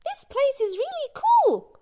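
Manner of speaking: very high and excited; high, bouncy little voice; tiny whale voice. Acted. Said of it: "Lula says".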